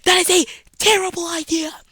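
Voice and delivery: high, loud cry of alarm; mock scream. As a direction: yell it like an inner warning siren. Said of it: "supposed to be screaming".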